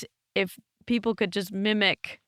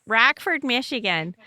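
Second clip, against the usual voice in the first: exaggerated Michigan accent, ROCKFORD as "Rackford"; 2 semitones higher than usual. Shown as a demonstration. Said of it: "horrible accent".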